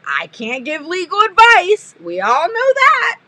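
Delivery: high, mock-innocent sarcasm; sarcastic. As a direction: say it with a knowing wink. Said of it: "I am being a sarcastic person right now".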